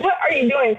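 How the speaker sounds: normal talking voice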